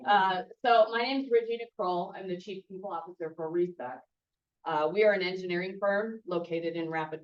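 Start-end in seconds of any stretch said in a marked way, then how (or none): 0.1-2.1 loud, projecting to the room; pep-rally voice, carry to the back; cheerleader voice. Real, not acted. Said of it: "I'll have to pull up my cheerleader voice".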